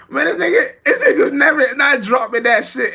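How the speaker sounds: high, loud, laughing through the words. Real, not acted. Streamer laughing at [name]'s endless album, high and loud. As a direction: crack up while mocking the rapper.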